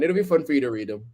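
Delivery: normal talking voice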